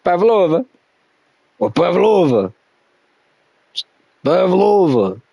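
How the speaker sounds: playful Russian-accented "Pavlova". Shown as a demonstration. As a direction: savour "Pavlova" like a Russian speaker. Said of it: "say it like a Russian person".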